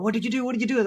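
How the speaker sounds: high-pitched mock-panicked demand; alarmed questioning. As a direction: imitate onlookers firing accusing questions. Acted out alarmed onlookers questioning him.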